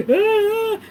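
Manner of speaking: high crying "ah"; mock sobbing wail. Reader mimics herself crying while driving, mock wail. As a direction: imitate breaking down, high wail. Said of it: "why I break out like that".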